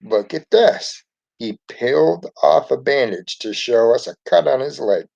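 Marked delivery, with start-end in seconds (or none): 0.0-1.0 mildly excited cry, pitched high; slow, high-pitched call of a boy with an idea. A narrator's reading. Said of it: "Ralphie cried out excitedly".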